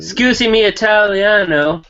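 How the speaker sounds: hint of a Mario-style Italian accent; light cartoon Italian accent. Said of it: "Italians all sound like, uh, Mario and Luigi".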